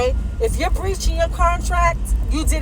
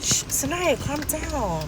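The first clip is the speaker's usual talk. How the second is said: quiet her down gently, hushed; hushing; 6 dB quieter, slower than usual. Real, not acted. Hushing Sinai after the screaming.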